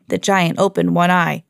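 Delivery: ordinary delivery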